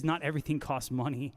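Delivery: ordinary delivery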